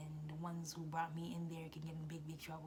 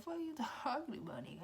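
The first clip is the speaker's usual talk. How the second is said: high, loud mock outrage; incredulous refusal; 8 semitones higher, 5 dB louder, faster than usual. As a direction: scoff loudly in high-pitched disbelief. Retold refusal of hug.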